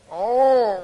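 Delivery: high, loud drawn-out "oh"; crowd's mock gasp. Onlookers reacting as the wife walks in, loud mock "oh". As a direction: play the whole crowd's dismayed groan. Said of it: "everybody goes".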